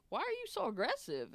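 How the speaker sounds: high-pitched retold protest; taken aback. Patient questioning the aggressive surgeon, taken aback. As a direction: ask it high, in startled disbelief.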